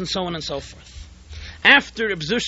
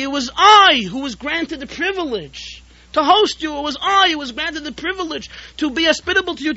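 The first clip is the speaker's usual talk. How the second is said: shouting, crying out; 9 semitones higher than usual. Acted. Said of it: "He shouted, he cried".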